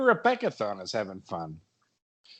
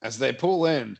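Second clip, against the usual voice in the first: ordinary talk, nothing marked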